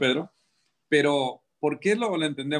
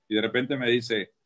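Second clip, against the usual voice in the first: normal talking voice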